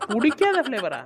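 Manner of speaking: loud, incredulous retort; indignant echo. Acted. Friend challenging the fussy patient, loud indignant echo. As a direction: throw the words back at her.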